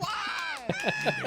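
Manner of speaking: sudden high shout of disbelief; outraged shout. Acted. Vampire lord shouting at his servant's lair design, outraged shout. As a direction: yell it at the top of your range.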